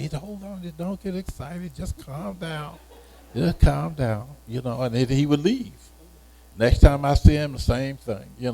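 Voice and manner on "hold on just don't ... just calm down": higher, much softer and slower soothing; calming. Retold or imitated. Instructor calming an excited student, soft and soothing. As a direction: settle him down gently.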